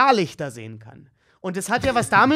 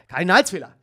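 normal talking voice

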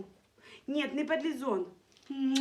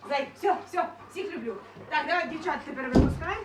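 ordinary talk

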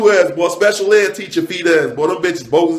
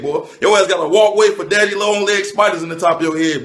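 ordinary delivery

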